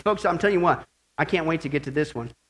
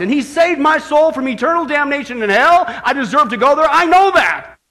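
raised, impassioned climax; full-force preaching; 10 semitones higher, 10 dB louder than usual. Real, not acted. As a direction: build to a fervent, shouted testimony.

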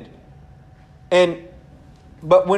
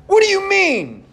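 much higher, overdone exclaim; big exclamation; 12 semitones higher than usual. Shown as a demonstration. Preacher demoing Nicodemus, hammed-up exclaimed. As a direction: really put emphasis on the exclaim. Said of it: "You gotta really put emphasis when it, the Bible says exclaimed".

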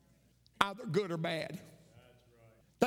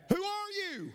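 very high mimicked call; echoed scream; 12 semitones higher than usual. Unseen voice answering the boy, high echoing call. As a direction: send the same high holler back. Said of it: "the voice came back".

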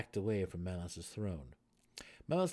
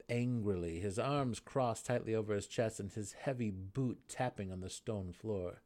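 usual speaking voice

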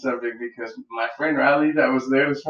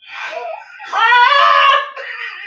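loud high laugh; 3 dB louder than usual. Real, not acted. Laughing at police report.

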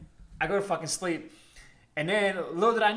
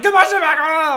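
high, loud mock-crazed yell; acting a fool; 8 semitones higher, 10 dB louder than usual. Storyteller reenacting his outburst at the dorm, loud wild yelling. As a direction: holler it wildly, ending in a yell. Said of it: "I was just acting a fool".

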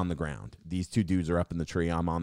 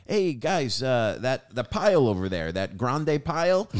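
higher-pitched mock uncle fumbling for Spanish words; uncle's bad Spanish; 6 semitones higher, 4 dB louder than usual. Uncle giving the Spanish-speaking crew orders, mock bad Spanish. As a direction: imitate an uncle faking Spanish. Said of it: "my uncle would talk Spanish to them".

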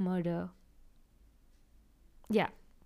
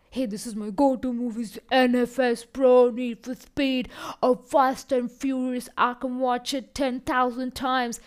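bro movie fan; 3 semitones higher, 6 dB louder than usual. Retold or imitated. People describing their favourite go-to movies, hyped bro voice. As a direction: gush like a die-hard fan. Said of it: "I know a couple of people who are like".

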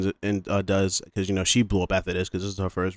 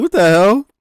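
high, loud what the hell; mock shock; 10 semitones higher, 9 dB louder than usual. Reacting to the killer attack, loud disbelief. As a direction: yell in disbelief.

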